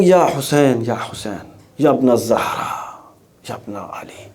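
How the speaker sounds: softly retold wail of Ya Hussain, fading away; a mild, fading echo of the scholar's weeping cry, mostly unvoiced at the end; imitating a crying lament. Preacher retelling a dream where his teacher weeps, mild imitated crying. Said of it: "And he's crying".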